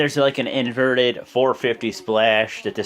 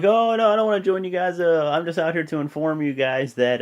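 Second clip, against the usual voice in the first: higher-pitched imitated protest; acted refusal; 6 semitones higher than usual. Titus O'Neil tells the guys he isn't joining, lightly imitated. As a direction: lightly act out someone declining, pitched up. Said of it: "pretty much is all like".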